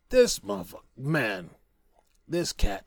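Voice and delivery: ordinary talk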